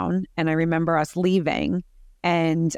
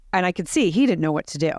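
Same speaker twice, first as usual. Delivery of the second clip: ordinary talk, nothing marked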